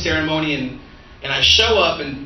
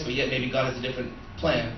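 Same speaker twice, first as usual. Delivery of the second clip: ordinary talk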